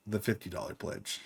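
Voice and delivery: unvoiced whisper, very soft. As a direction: breathe it like a secret. Whispered end of retold thought.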